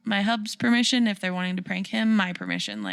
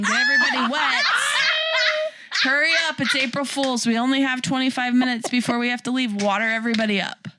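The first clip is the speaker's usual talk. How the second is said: much higher, laughing, fast mock yell; laughing mock shout; 4 semitones higher, faster than usual. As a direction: shout it through laughter as the misread order. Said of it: "she thought I was saying".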